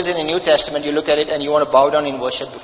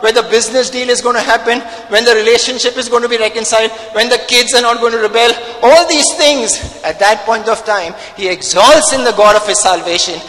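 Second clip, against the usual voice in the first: loud, driving pulpit preaching; full-force preaching; 5 semitones higher, 6 dB louder than usual. Acted. Pastor thundering at his church, loud preaching. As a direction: hammer it home to the congregation.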